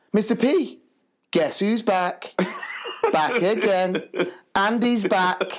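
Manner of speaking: high, drawn-out playground announcement; excited little lad. Retold or imitated. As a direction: imitate a boy proudly announcing news. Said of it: "this little lad runs to me".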